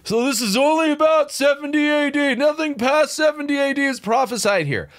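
high, hammed-up mock voice of a preterist. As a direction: parody the preterists in a silly high voice. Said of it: "the preterists out there are sitting there going".

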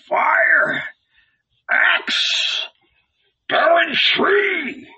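high, ragged prophet's cry from afar; wild hoarse cries. Acted. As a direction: howl the words like a madman in the desert. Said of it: "a wild, hoarse voice".

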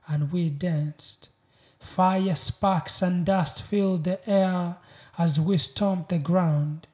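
proud, weighty spoken-word delivery; spoken-word poem. Acted. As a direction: declaim each line with deliberate pauses. Recites a poem.

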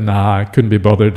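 light, low Australian brush-off; Australian lingo. Acted. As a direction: shrug it off in mild Aussie lingo. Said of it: "kind of the Australian for".

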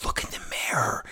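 soft whispered jab; whispered retort. Retold or imitated. Friend mocking Ronda's comeback, whispered. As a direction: whisper it cuttingly. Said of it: "And she goes".

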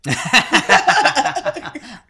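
high loud laughing burst; loud burst of laughter. Real, not acted.

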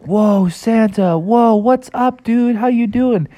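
put-on high, slow, excited kid voices; high excited children's voices; excited kids greeting. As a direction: cheer at Santa like starstruck kids.

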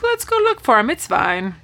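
high-pitched mock nonchalance; unconcerned woman. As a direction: voice her casually up high. Acting out the woman's reply.

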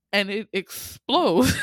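normal talking voice

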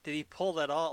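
high, loud disbelief; incredulous. Retold or imitated. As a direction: blurt it in total disbelief. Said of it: "and I'm like".

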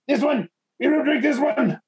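put-on high-pitched, emphatic Russian accent. Russian grabs a vodka bottle, high emphatic Russian accent. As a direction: announce the choice as a comic Russian.

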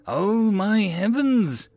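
high, slow exasperation; sighed. A narrator's reading. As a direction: draw it out, exasperated with the recruits. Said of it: "Arvin sighed".

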